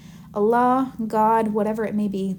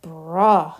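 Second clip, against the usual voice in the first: frustrated God; 5 semitones lower, 4 dB louder than usual. Acted. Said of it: "he's getting frustrated".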